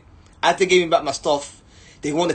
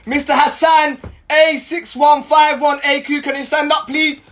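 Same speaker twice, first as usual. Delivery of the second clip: higher, louder formal summons; a hint of the official; 9 semitones higher, 5 dB louder than usual. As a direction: call the name out like a clerk. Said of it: "So they're like".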